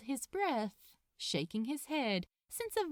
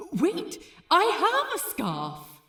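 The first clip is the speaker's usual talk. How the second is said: higher, louder sudden eureka; excited realization; 8 semitones higher, 10 dB louder than usual. Acted. Aziraphale remembers his scarf, excited and loud. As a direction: burst out with a bright idea. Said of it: "Aziraphale thought".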